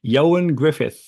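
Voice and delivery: Ioan as "YO-un", Gruffudd as "GRIFF-ith"; correct pronunciation. Shown as a demonstration. Co-host confirms the correct name, Ioan Gruffudd as "YO-un GRIFF-ith". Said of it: "that is the correct pronunciation".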